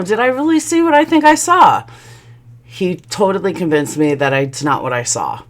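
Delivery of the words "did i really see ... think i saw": high, singsong self-doubt; self-questioning. Retold or imitated. Wife doubting her own eyes, self-questioning. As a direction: question yourself in a high, rising voice. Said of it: "I started questioning myself".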